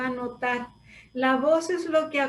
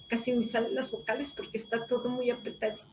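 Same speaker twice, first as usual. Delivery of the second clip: tense-mouth demo; 8 dB quieter, faster than usual. Shown as a demonstration. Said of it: "gente que habla con la boca tan tensa".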